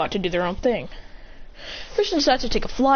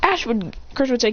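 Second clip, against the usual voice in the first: ordinary talk, nothing marked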